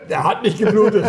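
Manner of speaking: high laughing protest. Pretend. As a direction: laugh through the words. Laughing protest after mock fight.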